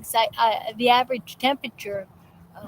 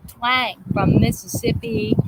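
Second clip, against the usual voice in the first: ordinary talk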